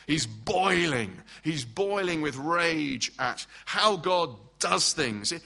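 boiling drawn out very slowly; slow emphasis. Acted. Preacher stressing Jonah's rage, slow and emphatic. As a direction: lean on each word, slow and weighty. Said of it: "Now he's really hot".

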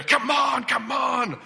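loud, high mock urging; hammed-up impatient "come on"; huffing at a slow queue. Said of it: "I'm huffing and puffing in their ear".

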